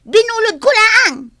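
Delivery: loud, high, bratty yell; spoiled child shouting at the maid. Acted.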